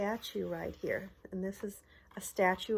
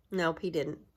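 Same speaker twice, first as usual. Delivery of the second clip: plain reading voice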